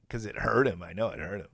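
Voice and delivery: ordinary delivery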